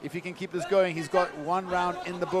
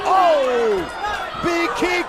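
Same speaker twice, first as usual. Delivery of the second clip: excited shout; 9 semitones higher, 7 dB louder, much slower than usual. Real, not acted. Sudden big kick in fight.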